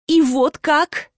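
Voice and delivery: seethe, stretching each word; worked up with rage or agitation; choked with fury. A narrator's reading. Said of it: "задыхаясь то ли от переполняющей её ярости".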